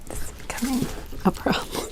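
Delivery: hushed aside to herself; whispering. Real, not acted. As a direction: whisper it under your breath. Tangled in mic cord.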